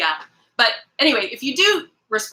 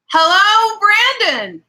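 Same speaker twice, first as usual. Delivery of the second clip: excited hello; 11 semitones higher, 6 dB louder than usual. Real, not acted. Greets a viewer joining.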